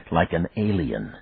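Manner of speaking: usual speaking voice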